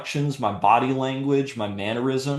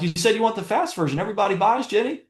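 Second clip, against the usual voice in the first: upbeat and higher, quick salesman's yes; matching her energy; 11 semitones higher, faster than usual. Retold or imitated. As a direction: bounce right back at her energy, eager. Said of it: "did you hear my vo-, my voice inflections".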